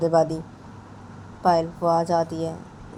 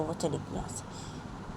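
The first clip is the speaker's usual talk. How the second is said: slow, hushed whisper; 11 dB quieter, much slower than usual. Acted. Poonam quietly telling Payal the girl left, whispered. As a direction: let it fade to a whisper.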